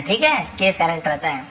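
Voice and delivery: usual speaking voice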